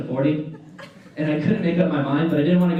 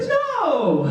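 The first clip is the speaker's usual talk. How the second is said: high squealed "Bitch, no!"; mock-outraged sharp refusal; emphatic refusal. Attendant offers water, high outraged no. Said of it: "I was like".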